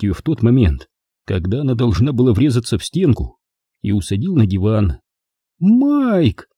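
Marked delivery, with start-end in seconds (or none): 5.6-6.5 high-pitched, drawn-out call of the name; wailed cry. A narrator's reading. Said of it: "завопила она".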